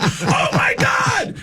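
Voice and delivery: very high, slow fan shriek; mock amazement. Audience member seeing rock stars up close, shrieked amazement. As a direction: stretch it out in screaming awe.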